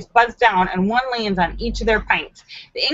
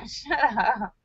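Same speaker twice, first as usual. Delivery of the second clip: ordinary delivery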